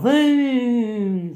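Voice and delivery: high, loud, long-held call; mimicked yell. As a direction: shout to stop someone walking off. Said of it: "that's how much you were yelling".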